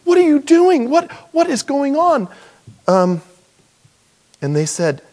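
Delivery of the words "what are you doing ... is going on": high, loud, alarmed woman's voice; flustered lady. Retold or imitated. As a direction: act out the startled mother. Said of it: "When a lady returns and she's saying".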